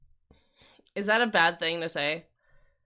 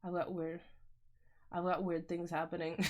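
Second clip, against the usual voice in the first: usual speaking voice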